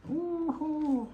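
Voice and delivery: high gleeful whoop; excited whoop. Pretend. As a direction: cheer like a delighted pet. Voicing the happy dog.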